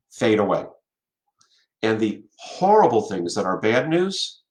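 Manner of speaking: usual speaking voice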